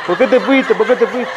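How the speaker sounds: high comic lament; mock crying. Mourner laments his wife's first husband, comic wailing. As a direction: repeat it as a hammed-up wail for the punchline. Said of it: "que estaba llorando".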